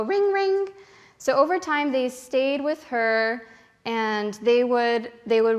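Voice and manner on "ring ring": parrot copying a ring. Retold or imitated. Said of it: "And the birds will go".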